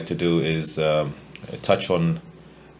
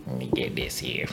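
whisper; 5 semitones lower, 8 dB quieter than usual. Real, not acted. Whispered aside before starting.

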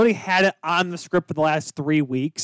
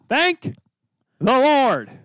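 high, loud, mock-grateful exclamation; dramatic reality show hype; 8 semitones higher, 4 dB louder, much slower than usual. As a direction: cry it out like a holy relief. Cohost joins the mock hype.

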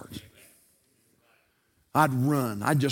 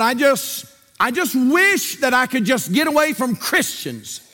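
loud, high, building preacher's cry; preaching at full force; 7 semitones higher, 5 dB louder than usual. Acted. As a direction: preach it out, voice climbing.

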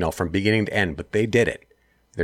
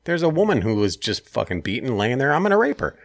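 high, hammed-up sneering thug; 6 semitones higher than usual.

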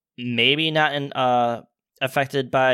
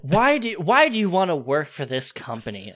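mock interviewer; 7 semitones higher than usual. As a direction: ask it like a stiff interviewer. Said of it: "Like, or like".

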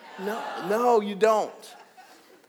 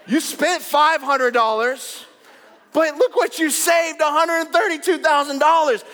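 mock delighted gushing; 9 semitones higher, 4 dB louder than usual. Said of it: "And you're like".

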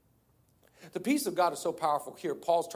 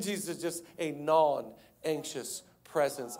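non-anxious calm; 2 semitones lower, 5 dB quieter, slower than usual. Acted. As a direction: settle right down into total calm. Jesus calm against frantic dad.

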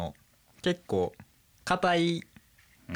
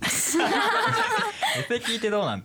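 drawn-out, high, laughing repeat of the question; teasing, slowed-down imitation of a fake Kansai accent; laughing mock echo; much slower than usual. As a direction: echo his fake Kansai line slowly through a laugh. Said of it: "エセ聞いてどうなんつってますけども".

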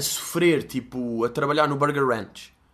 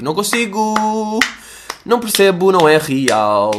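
exasperated; 6 semitones higher, 7 dB louder than usual. Real, not acted. Frustrated rant about yoga.

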